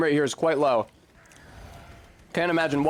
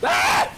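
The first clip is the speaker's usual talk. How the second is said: sudden high yelp of fright; startled scream; 5 dB louder than usual. Real, not acted. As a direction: jump out of your skin. Sudden jump scare in game.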